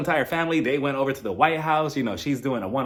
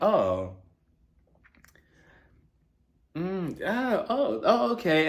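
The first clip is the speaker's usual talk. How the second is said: unimpressed stammer; 5 semitones higher, much slower than usual. Retold or imitated. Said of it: "he looks at her outfit like".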